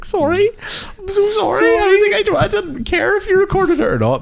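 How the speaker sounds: very high, mock pleading; squeaky fake apology. Mock grovelling apology to dad.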